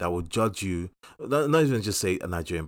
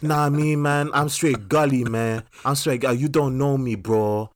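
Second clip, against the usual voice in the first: imitating an African guy's put-on American accent, higher and louder; hammed-up American street talk, pitched up; mock American swagger, pushed higher and louder; fake American accent; 6 semitones higher, 5 dB louder than usual. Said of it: "speak to you with this weird".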